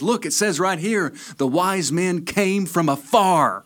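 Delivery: high, drawled Tennessee waitress; hammed-up Tennessee twang, stretching the punchline; Tennessee accent. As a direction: insist on it in a broad Tennessee accent. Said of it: "make it expressly from Tennessee".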